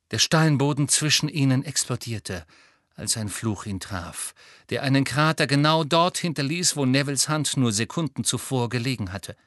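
ordinary delivery

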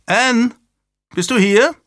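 high, loud calling voice; anxious call, pitched well up; worried call. A narrator's reading. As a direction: governess searching for a missing child. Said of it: "eine besorgte Stimme".

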